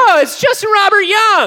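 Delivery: high, excited shout; hyped-up greeting. Pretend. Panelist hails an audience member, excited shout. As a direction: shout a name like a star just walked in.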